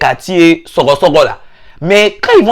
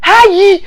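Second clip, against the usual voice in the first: mock shriek; 14 semitones higher, 4 dB louder than usual.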